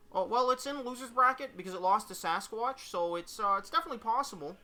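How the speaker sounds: high-pitched puppet reporter; hesitant explanation in a high Kermit voice. Acted. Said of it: "I gotta do the Kermit voice".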